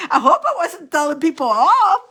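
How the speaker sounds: words bubbling with real laughter; laughing. Real, not acted. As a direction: giggle through the line. Laughs at herself over song.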